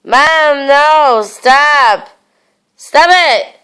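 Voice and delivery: loud pleading yell at mom. Real, not acted. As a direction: yell it out, upset. Yelling at mom to stop.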